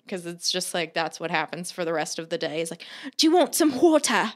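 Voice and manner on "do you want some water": higher, hammed-up British accent; an over-the-top mock British accent; aggressive British accent. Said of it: "aggressive, horrible accents".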